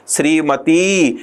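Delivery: Shrimati stretched slowly with a long final ती; Shrimati spoken very slowly for the class; drawn out. Shown as a demonstration. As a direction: draw out Shrimati to show the long ending. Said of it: "अंत में उच्चारण में अधिक समय लगता है".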